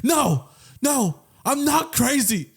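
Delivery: high, slow desperate protest; distraught girlfriend. Retold or imitated. Girlfriend insisting to her boyfriend she's not crazy, high and slow. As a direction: insist you're sane, stretched out. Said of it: "He's like ... No".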